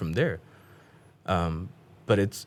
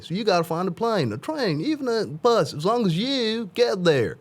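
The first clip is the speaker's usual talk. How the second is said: Dr. Phil voice continued; 12 semitones higher than usual. Retold or imitated.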